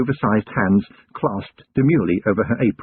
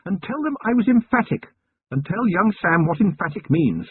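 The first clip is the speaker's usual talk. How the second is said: higher, refined lady's voice, curious; Lady Sybil voice; 9 semitones higher than usual. A narrator's reading. Wife questioning her husband about the goblin girl, higher refined lady voice. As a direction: play the poised lady of the house asking.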